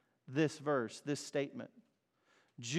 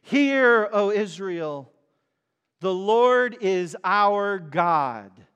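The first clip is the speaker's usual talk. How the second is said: a loud, high "Hear" and then a slow, declaiming "the Lord is our God"; proclaiming Moses; 4 semitones higher, 9 dB louder, slower than usual. Retold or imitated. Moses declaring to Israel, slow loud proclamation. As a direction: thunder the creed out to the whole people. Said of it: "He says".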